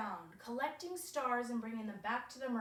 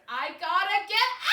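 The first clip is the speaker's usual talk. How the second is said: shouted, higher and louder; desperate shout; 9 semitones higher, 11 dB louder than usual. Retold or imitated. Trapped in the underworld, she shouts to escape. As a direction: yell to break free. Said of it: "I was like".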